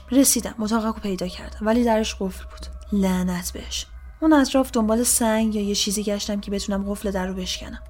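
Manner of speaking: plain reading voice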